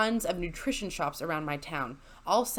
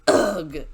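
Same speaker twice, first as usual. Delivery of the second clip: loud exasperated ugh; annoyed groan; 3 semitones lower, 8 dB louder than usual. Retold or imitated. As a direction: groan like a fed-up customer. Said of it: "he goes".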